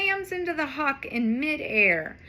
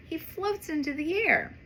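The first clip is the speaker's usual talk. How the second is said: plain reading voice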